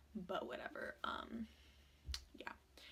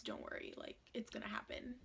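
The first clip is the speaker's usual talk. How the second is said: softer whispered reassurance; whispered promise; 9 dB quieter than usual. Real, not acted. Promising viewers the DMs, softly whispered.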